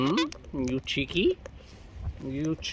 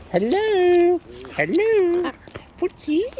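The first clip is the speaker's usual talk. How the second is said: high, louder sing-song greeting to a bird; sing-song hello; 12 semitones higher, 4 dB louder, slower than usual. Real, not acted. Greeting a wild bird, high louder sing-song. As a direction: coo a bright hello up high.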